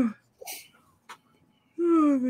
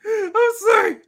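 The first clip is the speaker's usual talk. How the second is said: laughing apology; 13 semitones higher, 4 dB louder, slower than usual. Real, not acted. Apologizing while still laughing.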